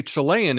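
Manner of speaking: Chilean said chuh-LAY-un, stress in the middle; mispronunciation. Retold or imitated. Host copying the seventies newscast's wrong Chilean, chuh-LAY-un. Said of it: "so many people say".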